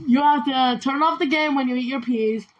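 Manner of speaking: usual speaking voice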